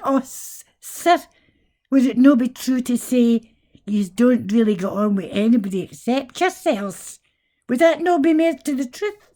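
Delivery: cheeky Scots comeback to a posh Englishman; mock posh challenge. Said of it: "Scots accent".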